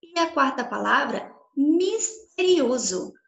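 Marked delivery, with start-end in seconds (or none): 1.6-3.1 slow, stretched-out word for dictation; over enunciating. Shown as a demonstration. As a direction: say every syllable slowly and clearly. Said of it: "Vou falar bem pausadamente".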